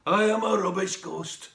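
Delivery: lightly voiced dejected old ghost; deflated grandad. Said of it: "like a deflated balloon".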